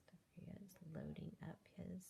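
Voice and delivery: hushed unvoiced aside about the picture; whispered aside. Real, not acted. Reader whispering an aside about the picture, hushed.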